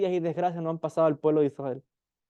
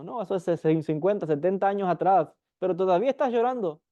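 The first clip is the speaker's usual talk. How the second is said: normal talking voice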